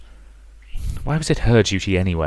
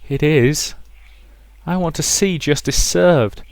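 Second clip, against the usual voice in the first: higher, slow, hollow demand; a betrayed dead woman urging murder; vengeful ghost; 8 semitones higher than usual. Acted. Dead mother urging Rosa to kill, vengeful slow voice.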